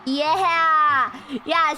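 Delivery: ordinary delivery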